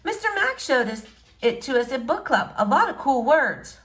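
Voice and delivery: put-on shy girl greeting a boy she likes.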